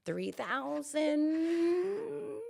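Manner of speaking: high, slow unsure question; confused guess. Retold or imitated. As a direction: drag it out, baffled. Guessing the exchange rate.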